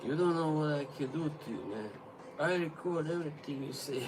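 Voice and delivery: put-on Carlos broken English accent. Streamer mimics Carlos with a mock threat in broken English. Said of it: "I can do his accent pretty well".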